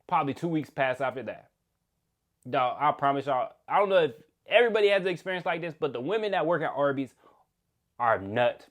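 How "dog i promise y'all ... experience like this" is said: normal talking voice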